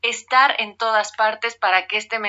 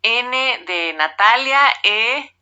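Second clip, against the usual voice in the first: spelling it out slowly; 3 semitones higher, much slower than usual. Shown as a demonstration. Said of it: "Siempre he sido malísima cuando te lo piden las telefonistas".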